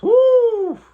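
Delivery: high, loud celebratory woo; excited whoop. Real, not acted. Streamer whooping at a viewer's big payout, excited. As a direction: whoop it up for a winning bet.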